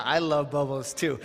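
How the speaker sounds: normal talking voice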